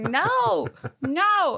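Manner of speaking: loud, high imitation of a frustrated child; annoyed toddler. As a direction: mimic a little girl insisting crossly. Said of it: "she kept getting so annoyed".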